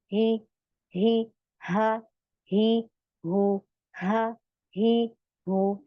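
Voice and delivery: हा ही हू drawn out slowly from the chest; slow drill. Shown as a demonstration. Teacher drilling the vowel forms of हा slowly. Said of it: "कैसे हम इसे पढ़ेंगे".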